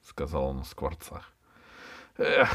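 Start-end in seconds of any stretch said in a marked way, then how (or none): 2.2-2.5 higher, bitter sigh of a word. A narrator's reading. Аркаша lamenting the starlings' fallen houses, bitter. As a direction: let out a rueful "ah". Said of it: "ответил с горечью Аркаша".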